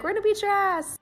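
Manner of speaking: lightly voiced schoolyard threat; mock threatening bully. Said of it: "follow me to the classroom and be like".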